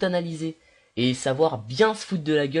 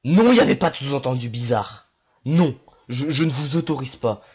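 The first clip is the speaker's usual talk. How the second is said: loud denial; 7 dB louder than usual. Real, not acted. Denying innuendo to viewers.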